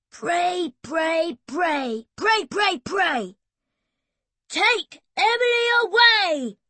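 loud, high chant of pray while winding; frantic shout. Acted. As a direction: yell it in a frenzy at the machine. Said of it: "he shouted".

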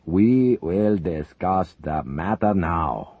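slow, hearty but commanding. Acted. Zelinkov overruling his friends, slow hearty command. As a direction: boom it out with false cheer. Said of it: "Zelinkov said heartily".

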